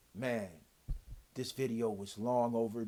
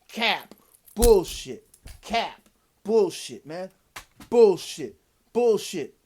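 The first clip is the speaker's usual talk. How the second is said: loud, higher barked calls of bullshit; repeated angry yells, much louder; yelling bullshit; 5 semitones higher, 10 dB louder, much slower than usual. Real, not acted. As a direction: shout each one down hard. Shouting down the dealership's claims.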